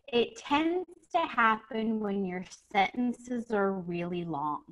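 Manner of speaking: normal talking voice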